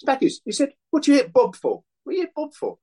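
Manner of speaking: high, excited London voice, quick questions; high-pitched mock Ian Wright demanding answers; Ian Wright impression. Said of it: "and he's going".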